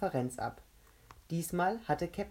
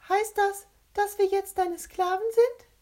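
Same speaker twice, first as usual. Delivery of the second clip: very high, loud character question; squeaky captain voice; 8 dB louder than usual. A narrator's reading. Captain Carr asks whether they are slaves now, squeaky and loud. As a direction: pipe it up forcefully in a squeaky voice.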